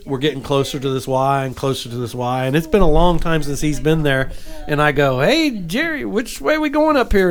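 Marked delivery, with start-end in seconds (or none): 5.2-7.3 calling out. Retold or imitated. Calling to father-in-law in boat.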